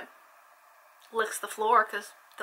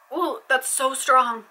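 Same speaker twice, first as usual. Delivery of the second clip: ordinary delivery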